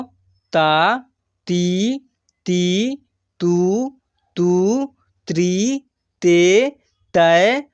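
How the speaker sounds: each syllable drawn out and intoned singly; syllable drill recitation. Shown as a demonstration. Teacher reads out barakhadi for practice, drawn-out recitation. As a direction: recite the alphabet slowly, stretching every vowel. Recites the letter series for learners.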